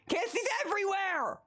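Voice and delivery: incredulous high outburst; exasperated. Real, not acted.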